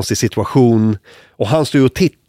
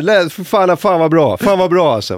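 high, excited calling out; thrilled, summoning colleagues to listen; officer gleefully praising the comeback, high; delighted cop; 8 semitones higher than usual. Retold or imitated.

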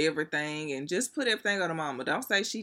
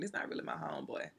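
whispered confession; 4 semitones higher, 10 dB quieter, faster than usual. Real, not acted. Confession aside.

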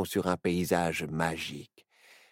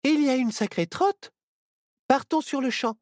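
higher, lightly voiced apprentice witch; a brisk young witch rallying the group; young witch Myrtille; 12 semitones higher, 3 dB louder than usual. Apprentice witch urges her friends to set off, brisk and higher. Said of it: "dit Myrtille".

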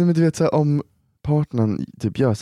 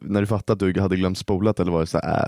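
ordinary talk, nothing marked

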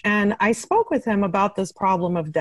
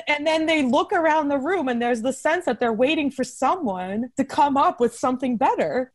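normal talking voice

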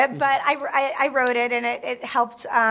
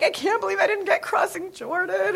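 mock whine; 8 semitones higher than usual. Retold self-pity after rejection.

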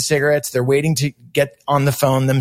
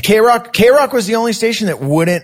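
excited insistence; 8 semitones higher, 3 dB louder than usual. Real, not acted. Heated recall of station refusing.

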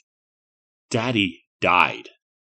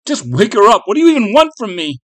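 high, loud plea that speeds up; frantic child's outburst; desperate demand; 11 semitones higher, 8 dB louder than usual. Acted. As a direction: beg in exasperation, rushing at the end. Boy begging the false daddy.

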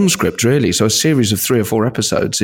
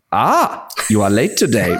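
German accent with "ah"; slower than usual. Shown as a demonstration. Voice actor using a noise to get into character, German accent. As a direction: start on a raised "Ah", then go German. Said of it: "can I just say 'ah' at the start?".

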